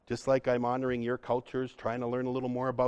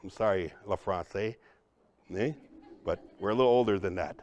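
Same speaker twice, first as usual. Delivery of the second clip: usual speaking voice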